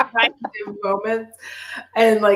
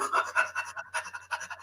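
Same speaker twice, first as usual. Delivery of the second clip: soft unvoiced laugh; 12 dB quieter than usual. Real, not acted. Laughing at breathing in mask.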